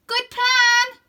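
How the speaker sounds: high, loud sincere approval; mean it for once; bright sisterly approval; Rose agreeing. A narrator's reading.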